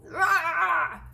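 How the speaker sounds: short hammed-up yell of frustration, high and loud; imitating a movie character's frustrated roar; mock shout. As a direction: bark out a pretend movie scream. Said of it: "screaming at the top of their lungs".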